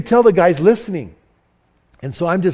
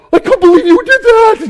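much higher, loud disbelieving cry; shocked scolding; 10 dB louder than usual. Retold or imitated. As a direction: yell it like a horrified friend. Larry scolds speaker, retold.

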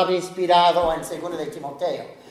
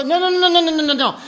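mock refusal; 8 semitones higher, faster than usual. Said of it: "Y hasta que".

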